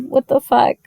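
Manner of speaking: ordinary delivery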